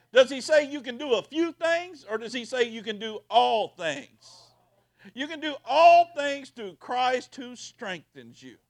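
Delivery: loud, high, slowing build; full-force preaching. Acted. Pastor driving home "all things" to the congregation, loud and high. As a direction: shout the promise over them.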